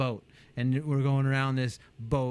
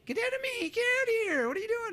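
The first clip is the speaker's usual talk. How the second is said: much higher, squeaky dismissive customer; mock brush-off. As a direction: shoo him away in a shrill mock voice. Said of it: "You'd be like".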